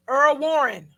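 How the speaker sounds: ordinary talk, nothing marked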